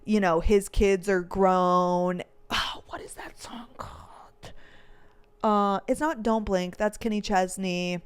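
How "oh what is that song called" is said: whispering to oneself; hushed, voiceless question to yourself; whispered aside. Real, not acted. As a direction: whisper it while searching your memory. Trying to recall a song title.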